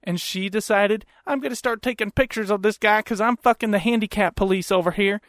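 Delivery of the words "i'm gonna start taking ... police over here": lightly voiced Southern lady; Southern accent. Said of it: "with that fucking accent".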